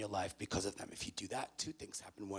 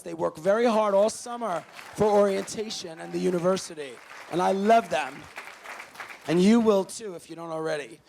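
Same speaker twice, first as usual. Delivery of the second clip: higher, louder pep-rally delivery; hype host energy; 10 semitones higher, 9 dB louder than usual. Real, not acted. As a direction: fire up a room of new students. Hype crowd-work with the audience.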